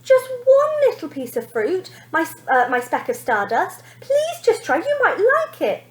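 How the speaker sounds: high-pitched begging father; father coaxing his daughter to try fruit; imploring. A narrator's reading. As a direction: plead gently with her. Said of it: "implored Father".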